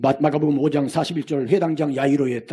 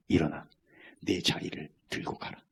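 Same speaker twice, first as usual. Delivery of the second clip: whisper; 6 semitones lower, 7 dB quieter than usual. Acted. Jesus speaks to sick man.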